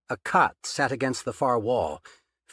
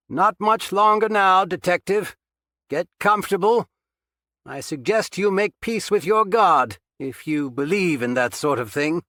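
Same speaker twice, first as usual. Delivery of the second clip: loud, higher mocking call from afar; taunting call; 8 semitones higher, 6 dB louder than usual. A narrator's reading. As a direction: taunt him from across the basement. Captor taunting trapped detective.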